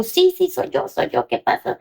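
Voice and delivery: anxious high-pitched reply; retold alarm. Mother answering a call about her crying daughter, alarmed. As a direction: answer urgently, re-enacting a worried parent.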